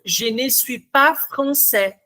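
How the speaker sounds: "Je ne suis pas français" with the S of "pas" silent, slow and clear; silent final S. Shown as a demonstration. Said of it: "vocês ouviram esse S final".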